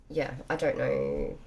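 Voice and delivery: slow drowsy mumble; nodding off. Real, not acted. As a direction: trail off half asleep. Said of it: "I'm so tired, half asleep".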